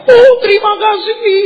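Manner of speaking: delighted surprise. Acted. Lender squeals thanks when the old debt is repaid, high and loud. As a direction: gush your thanks like a stunned, happy lender.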